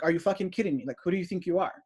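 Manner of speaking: usual speaking voice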